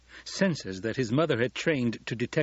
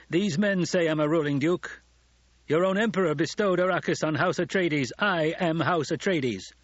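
high, raised, proud proclamation; commanding declaration; 7 semitones higher, 3 dB louder than usual. A narrator's reading. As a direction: assert your rank forcefully, slowing at the end. Duke asserts rule to challenger.

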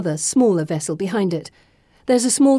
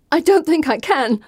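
high, queasy protest; balk, on the edge of retching; gagging; 7 semitones higher, 3 dB louder than usual. A narrator's reading. Heroine facing a stinking sewer, gagging. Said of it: "I say, gagging".

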